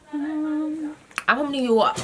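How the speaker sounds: put-on high, very drawn-out New York accent; mock New York accent. Vlogger showing off her favourite accent, mock New York. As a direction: stretch it out in hammed-up New Yorkese. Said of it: "I like New York accent".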